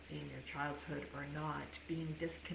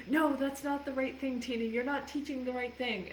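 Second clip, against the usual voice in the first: scolding Christians; 8 semitones higher, 5 dB louder than usual. Retold or imitated. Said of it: "Christians are rolling over in their grave going".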